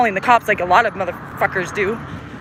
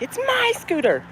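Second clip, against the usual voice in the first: put-on drawn-out high kid whine; screaming little kid; 14 semitones higher, 4 dB quieter, slower than usual. Other kid yelling back over the scooter, mock screaming. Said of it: "screaming at each other".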